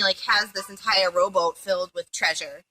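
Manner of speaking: normal talking voice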